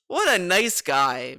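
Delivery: usual speaking voice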